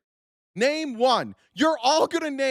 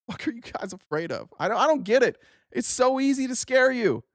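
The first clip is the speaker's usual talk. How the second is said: ordinary talk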